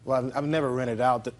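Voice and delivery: normal talking voice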